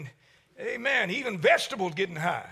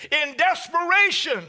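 climbing preacher shout; 6 semitones higher, 3 dB louder than usual. Acted. Preacher repeats, rising.

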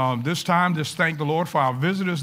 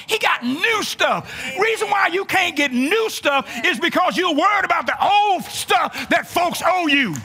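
full-force preaching; 9 semitones higher than usual. Acted. Pastor exhorting the congregation, full preaching shout.

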